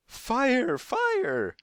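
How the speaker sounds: very high, loud shriek of alarm. A narrator's reading. Woman with parrots raises the alarm, shrieking. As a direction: shriek it at the top of the voice. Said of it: "shrieked the woman with the parrots".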